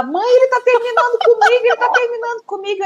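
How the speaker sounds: high-pitched mock wail to mum; high, hurried panic of a dumped daughter, played lightly; retold tearful daughter. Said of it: "ela chorava, chorava".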